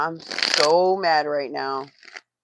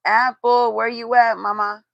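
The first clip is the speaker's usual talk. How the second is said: loud, higher, playful call; 6 semitones higher, 8 dB louder than usual. Real, not acted. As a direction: call out to her across the room. Calling out for her sister.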